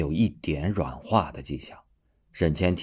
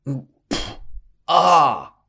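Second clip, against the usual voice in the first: pained scream; 10 semitones higher, 6 dB louder, much slower than usual. Acted. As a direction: grunt then loud cry of pain. Crushed by the cauldron.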